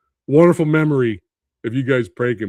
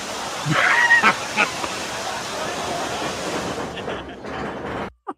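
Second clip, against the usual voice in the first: long breathless high wheeze; wheezing laugh; 4 dB quieter than usual. Real, not acted. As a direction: laugh till the voice gives out. Watching the splash replay.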